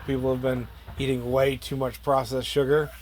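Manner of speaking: ordinary delivery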